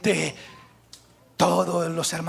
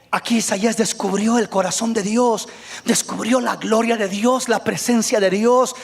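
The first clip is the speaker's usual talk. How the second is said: normal talking voice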